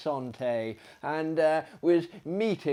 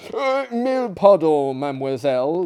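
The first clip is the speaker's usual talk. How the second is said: higher, louder, flustered apology; a hint of a fussy Frenchman begging pardon; lightly voiced de Kock apologising; apologetic gentleman; 4 semitones higher, 8 dB louder than usual.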